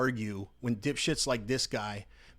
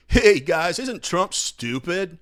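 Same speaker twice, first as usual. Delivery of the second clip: hammed-up stand-up voice, louder and higher; mock comedian, loud and high, slow punchline; 6 semitones higher, 9 dB louder, much slower than usual. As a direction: parody a hack comic's opener. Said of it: "ninety percent of his material is".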